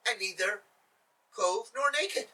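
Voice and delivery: ordinary delivery, nothing marked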